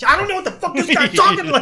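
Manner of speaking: high, loud, furious mobster rant; mock mobster rage pitched high and loud; angry mobster. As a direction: blow up at the guy.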